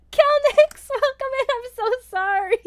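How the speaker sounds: high, loud squealed welcome; giddy apology. Real, not acted. Streamer welcoming a viewer mid-blunder, high giddy apology. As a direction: greet them in a squeal, easing down into sorry.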